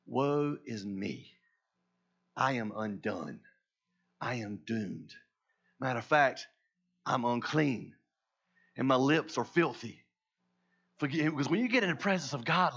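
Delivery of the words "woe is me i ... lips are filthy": low, slow, hushed despair; stricken confession. Acted. Isaiah in God's presence confesses his uncleanness, stricken. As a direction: confess it haltingly, weighed down with dread. Acts out Isaiah undone before God.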